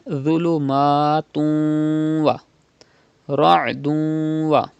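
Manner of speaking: Dhulumatun wa slow, nun merged into wa with nasal hum; nasal merging demo. Shown as a demonstration. Teacher models idgham with gunna, slow nasal. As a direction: slowly merge tanween into wa through the nose. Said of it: "You have to pronounce like this".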